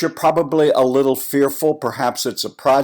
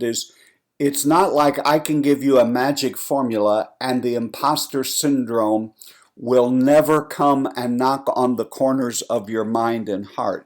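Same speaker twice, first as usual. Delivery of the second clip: normal talking voice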